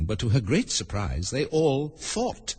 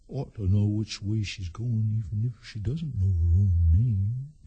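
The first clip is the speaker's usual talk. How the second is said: slow, drawn-out character voice; slow, ponderous gentleman; slower than usual. Acted. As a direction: draw each word out ponderously. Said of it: "said the gentleman sitting opposite".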